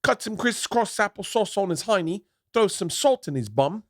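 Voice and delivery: high, hammed-up cockney threat; over-the-top cockney gangster boast; British gangster accent. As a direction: tell the gangster tale in a put-on London accent. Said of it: "can't do the accent".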